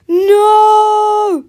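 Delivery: long, high scream; desperate cry. A narrator's reading. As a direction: scream to stop them. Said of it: "cried George".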